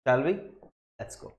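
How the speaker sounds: ordinary talk, nothing marked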